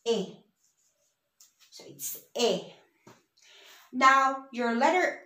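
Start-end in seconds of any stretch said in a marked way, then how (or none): 0.1-0.4 "A" said as a pure "eh"; Spanish E sound. Shown as a demonstration. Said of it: "would always sound as". 2.4-2.7 "A" said as a pure "eh"; Spanish E sound. Shown as a demonstration. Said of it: "would always sound as".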